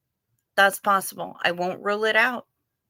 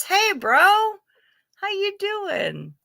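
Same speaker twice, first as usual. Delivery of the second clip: mock bro greeting; 12 semitones higher, 3 dB louder, much slower than usual. Greeting a chatter in a put-on voice.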